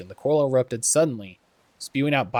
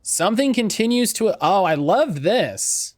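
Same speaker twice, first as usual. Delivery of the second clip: excited; 8 semitones higher, 5 dB louder, slower than usual. Real, not acted. Delighted by game discovery.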